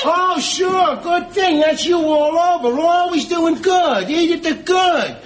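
high-pitched, mocking praise; sarcastic. Husband mocks his wife for always doing good, sarcastic. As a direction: jeer at the apology as if praising.

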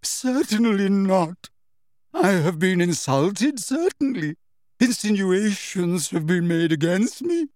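high, melodramatic, wounded protest; throbbing with emotion. A narrator's reading. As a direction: declaim each grievance with quivering outrage. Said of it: "in a voice throbbing with emotion".

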